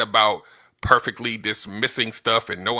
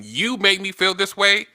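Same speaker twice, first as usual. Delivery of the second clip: accusing you statement; 9 semitones higher than usual. Shown as a demonstration. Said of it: "versus saying".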